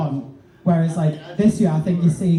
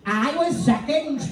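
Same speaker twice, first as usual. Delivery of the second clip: higher, slow, put-on voice of a friend boasting; Stacey impression; 8 semitones higher, 3 dB quieter than usual. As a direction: stretch it out in a high mock voice as Stacey. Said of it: "that's my Stacey".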